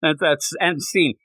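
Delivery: ordinary talk, nothing marked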